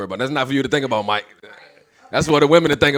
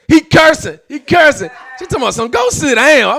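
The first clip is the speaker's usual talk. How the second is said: much higher, louder exasperated shout; exasperated yelling; 11 semitones higher, 7 dB louder than usual. Retold or imitated. Retelling kid misbehaving.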